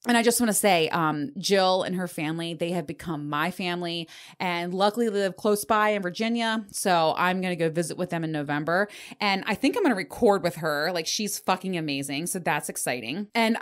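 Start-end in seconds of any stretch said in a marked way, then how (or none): none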